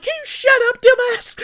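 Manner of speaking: put-on high, loud snotty kid voice; snarky twelve-year-old snapping at the man; bratty kid insult. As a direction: spit it out like a snarky brat.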